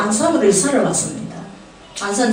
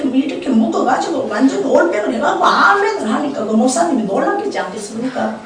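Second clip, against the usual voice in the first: usual speaking voice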